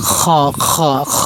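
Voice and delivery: heavy, scraped খ, repeated as a drill; rasping throat sound. Shown as a demonstration. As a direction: let the friction of খ sound out at the back of the throat. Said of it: "একটা শব্দ হয়".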